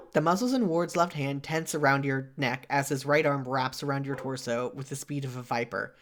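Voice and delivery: ordinary talk, nothing marked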